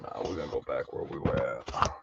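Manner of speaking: Dark Knight voice. Pretend. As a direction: drop way low like a masked vigilante. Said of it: "are you doing your Dark Knight impression".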